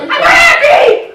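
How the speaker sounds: very high, loud and slow; shrieked cheer. Acted. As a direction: scream your happiness at him. Shrieked fake happiness.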